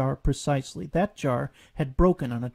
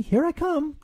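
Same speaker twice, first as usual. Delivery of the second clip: put-on high little child voice; eager Roo; 14 semitones higher, 5 dB louder than usual. A narrator's reading. Roo jumps out to his mother, childlike. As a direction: announce the jump brightly.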